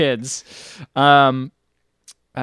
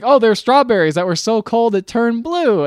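put-on kids' fib; 9 semitones higher, 5 dB louder than usual. Kids lying to the captain.

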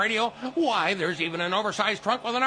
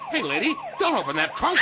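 panicked shout; 8 semitones higher than usual. Acted. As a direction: yell out to stop her. Panicked warning to customer.

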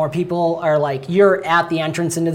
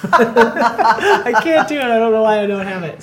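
high, loud words through helpless laughter; real giggling fit; laughing while talking; 6 semitones higher, 4 dB louder than usual. Real, not acted. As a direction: crack up admitting defeat. Laughing at own failed Scottish.

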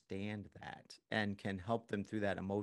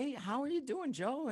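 put-on bright, much higher friendly hello to an old classmate; cheery phone greeting. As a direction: sing out the hello like greeting a long-lost friend. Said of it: "call up your friend from high school and say".

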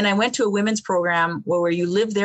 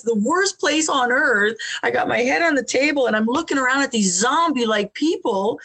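ordinary talk, nothing marked

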